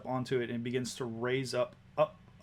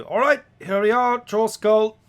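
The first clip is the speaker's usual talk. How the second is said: loud, high driver's announcement; yelling back; 9 semitones higher, 11 dB louder than usual. Acted. Carriage driver yells the arrival back to passengers. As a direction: shout the stop to the passengers behind. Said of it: "she yells back to you".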